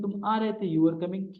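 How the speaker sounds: ordinary talk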